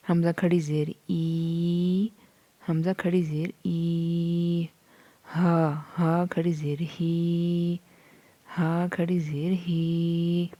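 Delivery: slow, stretched lesson chant; Qaida recitation. Shown as a demonstration. Teacher drilling letters for students, slow chant. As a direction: lengthen each long vowel.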